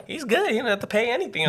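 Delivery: very high imitation of a lady clerk; reassuring courthouse lady. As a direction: wave it away in a high voice.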